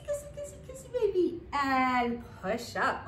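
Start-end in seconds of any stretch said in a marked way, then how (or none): 0.1-1.4 put-on high, playful cooing to a baby; baby talk. Shown as a demonstration. Instructor kissing baby on the way down, high baby talk. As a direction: coo in a tiny, high voice.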